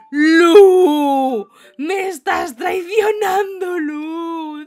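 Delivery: loud, slow, mock-anguished crying shout; hammed-up wail. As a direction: wail it like a betrayed soap heroine. Mock betrayal by game character. (lamento exagerado y teatral, gritando)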